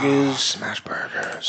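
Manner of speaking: longing whisper. Real, not acted. Dreamy whispered echo of food.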